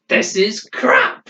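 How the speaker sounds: high, slow, hammed-up old man's outburst; drawn-out mock yell in a put-on old voice; mock angry old man. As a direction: throw it out like the grumpy old man again. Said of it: "that's his word".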